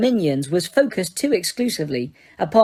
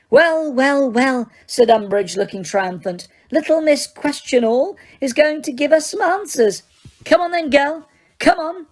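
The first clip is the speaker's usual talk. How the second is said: high, gloating coax; triumphant Umbridge; 7 semitones higher than usual. Acted. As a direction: relish the win.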